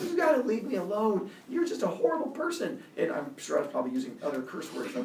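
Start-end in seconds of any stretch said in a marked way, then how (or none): 0.0-2.8 retold screaming. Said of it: "I started screaming into his face".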